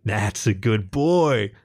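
slow, drawn-out praise; indulgent praise. A narrator's reading. Jackal praises the fox, slow and indulgent. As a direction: stretch the words out, pleased.

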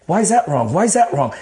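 pressing, repeated challenge; insistent self-questioning. Retold or imitated. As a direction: keep asking yourself, pitch climbing. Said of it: "I kept on asking myself".

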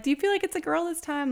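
curious acquaintances. Retold or imitated. People asking about pregnancy.

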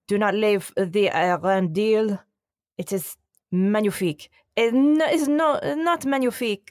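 a light French accent voicing a president; mock French accent. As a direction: play Macron scolding Trump in a French accent. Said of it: "but in a beautiful French accent".

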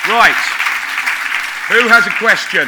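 loud, high call to the room. Real, not acted. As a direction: throw it out to the whole hall. Host calling to audience for questions.

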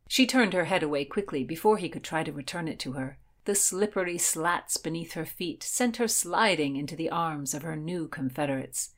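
ordinary delivery

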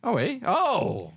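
high startled oh; surprised. Real, not acted. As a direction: caught off guard, pitch jumps up. Surprised by trip news.

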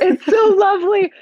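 high, laughing sweet grandma. Acted. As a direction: coo it like a doting granny. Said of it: "such a grandma".

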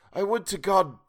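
high wish, suddenly cut off; checking himself. A narrator's reading. Old man starting a wish, checking himself. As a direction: begin fervently, then stop dead. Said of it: "checked itself as suddenly".

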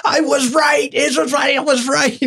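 high, laughing triumphant shout; gloating. Retold or imitated. Brother crowing to family he was right, high triumphant shout. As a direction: crow about winning, laughing. Gloating after pastor's answer.

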